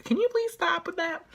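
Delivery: high, pleading "please stop" at the show; mock-begging the editors to drop the riff; exasperated plea. Fan begging the show to stop the piano riff, high and exasperated. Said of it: "so I'm like".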